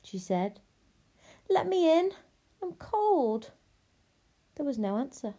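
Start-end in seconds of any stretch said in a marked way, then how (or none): 1.5-3.5 high-pitched plea at a door; pleading princess. Acted. Lost princess knocks at the hut, pleading. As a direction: plead to be let in, voice raised high.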